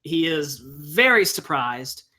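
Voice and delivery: ordinary talk, nothing marked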